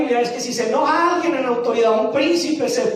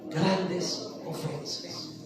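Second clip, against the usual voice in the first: slow, much softer whisper; whispered answer; 8 semitones lower, 12 dB quieter, much slower than usual. Acted. Preacher answering his own question in a slow whisper. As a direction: let the words fall to a hush. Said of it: "qué?".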